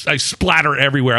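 ordinary delivery

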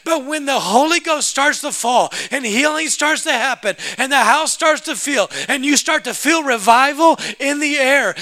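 high, loud, building sermon cry; impassioned revival preacher at full voice; full-force preaching. Real, not acted. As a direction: preach it with rising fervor.

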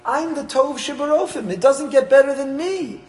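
pompous boasting doctor. Retold or imitated. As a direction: stretch it out like a doctor full of himself. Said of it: "He says".

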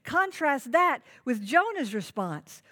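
usual speaking voice

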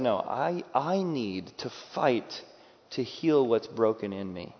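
normal talking voice

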